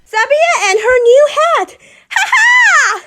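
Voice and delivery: very high, loud, cackling punchline; laughing hyena. Acted. Linda the hyena laughing at her joke, high cackle.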